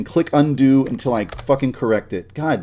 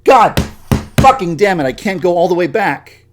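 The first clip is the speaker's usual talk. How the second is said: much higher and louder furious shouting; 6 semitones higher, 8 dB louder than usual. Real, not acted. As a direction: yell at the software in real rage. Raging at editing glitch.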